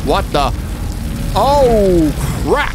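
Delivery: high, loud jump scare yelp; startled shriek. Real, not acted. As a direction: caught off guard, shriek it. Sudden scare in horror game.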